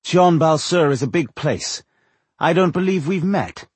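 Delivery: high haughty stranger; arrogant elf woman. Acted.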